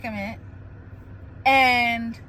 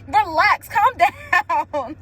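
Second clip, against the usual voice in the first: laughing through the words, a little louder; 4 semitones higher, 5 dB louder than usual. Real, not acted. Laughing at herself to a friend, real laughter. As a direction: giggle it out while telling herself to relax. Laughing at her own aggressiveness.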